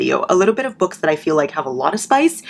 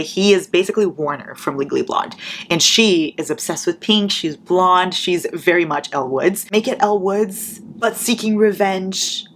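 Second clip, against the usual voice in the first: ordinary talk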